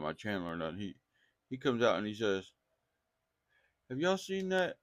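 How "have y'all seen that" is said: higher, lightly voiced curious coworker; voicing the coworker. Coworker's question voiced in retelling.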